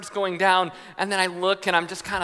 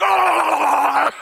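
loud, high wordless roar; hammed-up monstrous bellow; screaming monster; 9 semitones higher, 7 dB louder than usual. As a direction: roar like a monster in mock fury. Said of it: "like, screaming monster thing".

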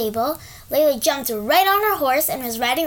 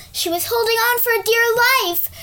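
usual speaking voice

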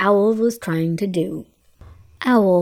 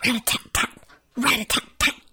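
soft, breathy tapping rat-a-tat; a woodpecker pecking at bark; 2 semitones higher, 4 dB quieter, much faster than usual. Acted. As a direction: tap it out like a woodpecker. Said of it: "The woodpecker pecked".

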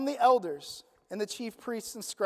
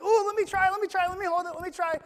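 bouncy, higher, eager plea; eager Peter; 6 semitones higher, faster than usual. Retold or imitated. Preacher acting out Peter on the water, eager. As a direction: beg like a kid who wants a turn. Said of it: "Peter's like".

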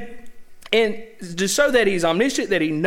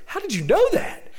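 high-pitched astonished question; mock astonishment, pitch jumping up; amazed question; 11 semitones higher than usual. Preacher voices Nathaniel questioning Jesus, amazed.

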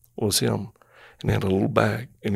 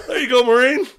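excited shout; 6 dB louder than usual. Retold or imitated. Dave praising after fight.

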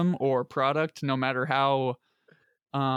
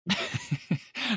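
laughing; 9 semitones higher, 5 dB quieter than usual. Real, not acted. Laughs at aging joke.

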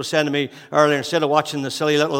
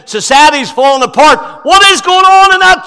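high, loud mock plea; pleading rulers; 13 semitones higher, 15 dB louder than usual. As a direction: cry out as desperate leaders. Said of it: "the leaders ... are coming and saying".

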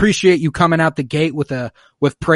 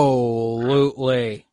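slow, stretched-out parody of a reply; drawn-out mimicking echo; slower than usual. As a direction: echo it back, stretching every syllable. Echoes guest's sign-off.

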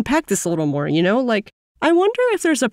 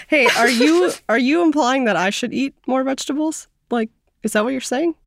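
lightly acted friend, higher-pitched questioning; sensitive friend; 6 semitones higher than usual. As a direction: play a mildly touchy friend asking for clarification. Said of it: "your friend says something a little more normal".

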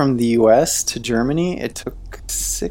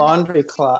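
normal talking voice

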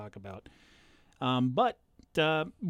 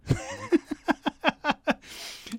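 laugh. Real, not acted. Laughing at own forgetting.

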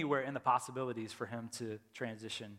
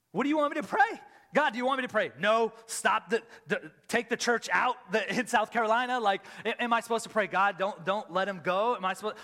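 desperate prayer; 5 semitones higher, 7 dB louder than usual. Retold or imitated.

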